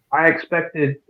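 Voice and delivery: ordinary talk, nothing marked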